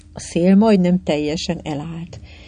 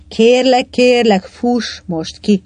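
higher, louder begging, slowing at the end; pleading; 5 semitones higher, 4 dB louder, slower than usual. A narrator's reading. Titti begging the boat to run out, pleading urgently. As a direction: implore with drawn-out final words. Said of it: "rimánkodott Titti".

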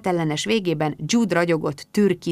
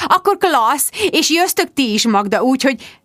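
high-pitched, eager gushing; high, bubbly inviting; effusive hostess; 7 semitones higher, 4 dB louder than usual. A narrator's reading. Rebecca gushes, settling the invitations.

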